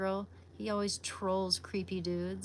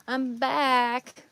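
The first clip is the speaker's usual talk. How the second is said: cheery return; 4 semitones higher, 8 dB louder than usual. Real, not acted. Returning to the stream.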